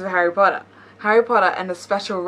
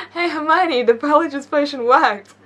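character voice; 7 semitones higher, 5 dB louder than usual. Acted. Voicing a character in meme.

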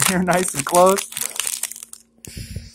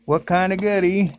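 usual speaking voice

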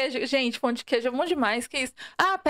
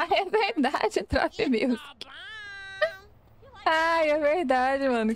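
laughing agreement, slow and amused; 4 semitones higher, slower than usual. Real, not acted. Streamer laughing at a viewer's joke, amused. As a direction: chuckle through the words.

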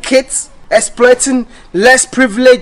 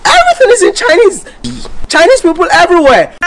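much higher and faster, in disbelief; a pitched-up exasperated repeat; exasperated outburst; 9 semitones higher, 3 dB louder, faster than usual. Real, not acted. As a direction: fire it off high, fed up. Repeats the complaint, pitch jumps.

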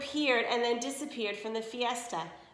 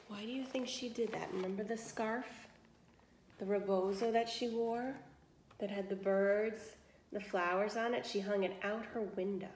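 quiet aside; 2 semitones lower, 7 dB quieter than usual. Real, not acted. Reader pauses to ask children, softly. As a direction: lean in and ask the listeners. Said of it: "narrator asks listeners aside".

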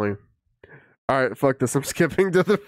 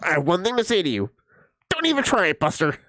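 squeaky mocking tough-guy voice, pitched way up on the warning; mock threat; 5 semitones higher, faster than usual. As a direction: parody a kid's empty threat, shrill and high. Streamer mimics kid's threat.

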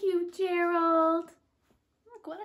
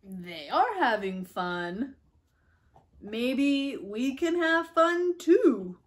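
slow Gerald; 2 semitones higher, 12 dB louder, much slower than usual. Acted. Gerald watching the worms, slow and thoughtful. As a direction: muse thoughtfully and slowly.

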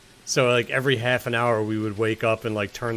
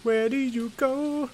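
much higher and slowed, drawn out; high slow silly voice; 13 semitones higher, slower than usual. Pretend. Asking the door where it goes, high slow goofy voice. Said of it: "repeated in funny voice".

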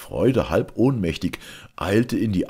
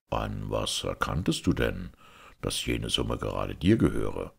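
low, measured ruler's voice; a lightly lowered voice of authority; lightly voiced Caliph; 6 semitones lower than usual. A narrator's reading. Caliph questioning his judge, lightly voiced and low.

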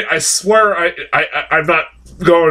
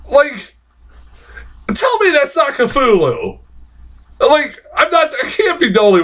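excited; 11 semitones higher than usual. Real, not acted. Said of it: "I got all worked up".

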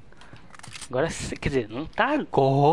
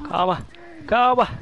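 urgent shout; 6 semitones higher, 7 dB louder than usual. Real, not acted. Panicking during firefight.

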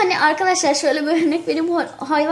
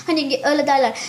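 normal talking voice

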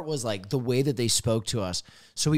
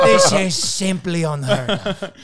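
hammed-up grand waiter; 7 semitones higher, 9 dB louder than usual. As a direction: announce it like an outrage, laughing. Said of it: "He's like".

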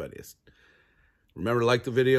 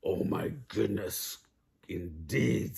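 measured fox voice, in the low register; smooth fox; 3 semitones lower, slower than usual. A narrator's reading. Fox answers Chicken Little's alarm, unhurried and low. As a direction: echo the panic calmly, as a fox. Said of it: "said the fox".

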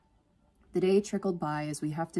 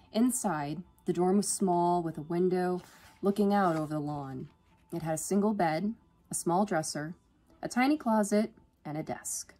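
usual speaking voice

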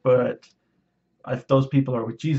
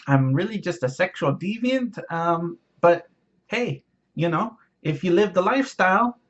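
higher mocking voice of a greedy preacher; mock him in a higher, smug voice; impersonation of Mario; 5 semitones higher than usual. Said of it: "this would be my impersonation of Mario".

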